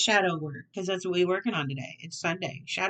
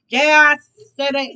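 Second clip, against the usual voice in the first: louder, punching out yeah; loud defiant repeat; 6 semitones higher, 11 dB louder than usual. Acted. Tarot reader doubling down to her viewers, loud and defiant. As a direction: own it loudly and defiantly.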